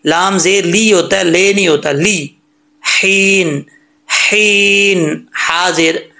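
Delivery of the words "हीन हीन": very slow हीन with thin h from the throat. Shown as a demonstration. Teacher drilling the letter, drawn-out recitation. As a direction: breathe the h, then hold हीन long. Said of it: "हा बारीक है".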